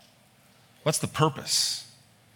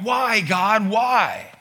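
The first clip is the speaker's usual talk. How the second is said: high loud desperate cry of why; imitated cry to God; 8 semitones higher, 4 dB louder, much slower than usual. Unbeliever crying out to God, high loud desperate plea. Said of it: "they've cried out".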